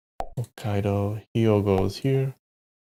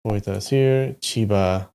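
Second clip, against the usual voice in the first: ordinary delivery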